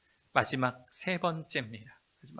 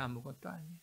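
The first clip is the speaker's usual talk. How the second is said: much softer, drop to a hush; soft echo; 9 dB quieter, faster than usual. Real, not acted. Preacher echoing to congregation, soft fading repeat. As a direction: let the words fall away quietly.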